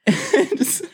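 helpless laughter breaking up an apology; high, real giggles between words; real laughing fit. Real, not acted. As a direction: beg for a moment while cracking up. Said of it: "you laughed for, like, five minutes".

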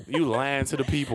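ordinary talk, nothing marked

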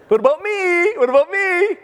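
very high, loud mock whine for attention; attention-seeking best man. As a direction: squeal it like a show-off stealing the spotlight.

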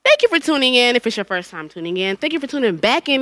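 usual speaking voice

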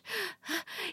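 high sharp gasp; gasp, caught off guard; flustered gasp; startled gasp. A narrator's reading. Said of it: "she croaked".

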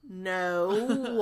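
weary, drawn-out sighing no; long sigh. Acted. Brandon answers the magical message, sighing. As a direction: let the word sag out in a long sigh. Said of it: "that long sigh again".

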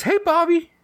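put-on high toddler voice calling out; little girl voice. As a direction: chirp it like a three-year-old. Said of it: "and she says".

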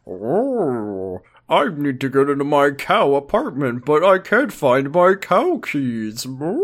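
cow voice. Acted. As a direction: whine like a lost cartoon cow. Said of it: "What about, like".

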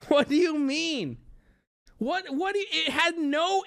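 high, incredulous protest; indignant disbelief. Real, not acted. Streamer protesting a chat jab about the shirt, indignant. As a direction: push back in offended disbelief.

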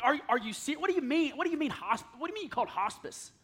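retold outburst of disbelief at a doctor; angry disbelief. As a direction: confront the doctor, stumbling over the words. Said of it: "cussing at the doctor".

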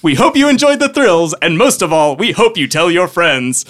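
theme-park announcer at full volume; booming announcer. Acted. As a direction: boom it out to the adventurers. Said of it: "A loud voice booms".

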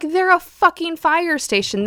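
high, exasperated retort; indignant retelling of her reply; retold outrage. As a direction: let the disbelief spill over. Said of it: "And I'm like".

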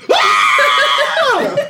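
high loud shriek breaking into a laugh; horrified scream. Acted. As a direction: shriek in horror at the fire. Horrified at burning archer.

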